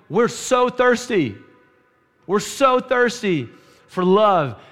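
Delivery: loud, high, impassioned sermon build; fervent preaching. Acted. Pastor urging the church about thirsty souls, fervent preaching. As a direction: preach it hard to the congregation.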